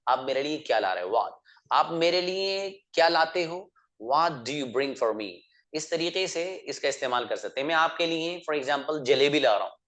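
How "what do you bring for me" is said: very slow, every word spelled out for learners; slow model sentence. Shown as a demonstration. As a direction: say it slowly so students can repeat. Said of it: "teacher models English sentence". (बहुत धीरे, साफ़ बोलकर)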